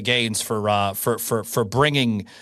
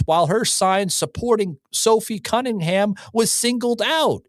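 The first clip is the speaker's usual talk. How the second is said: higher, rising heated rant; 6 semitones higher than usual. Real, not acted. Host ranting to viewers about the league, heated and rising. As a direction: get worked up and let it climb.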